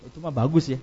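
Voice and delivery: usual speaking voice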